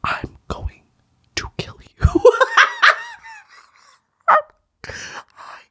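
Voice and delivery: playful whispered threat with laughs; mock menacing whisper. Whispering a fake threat at the guy in the video. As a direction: joke a threat under your breath.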